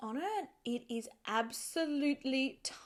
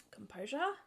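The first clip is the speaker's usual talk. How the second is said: normal talking voice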